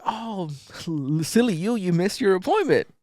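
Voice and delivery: high, loud fake-sweet taunt with a laugh; mocking sing-song. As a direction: tease her like a child, overly sweet. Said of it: "He's like".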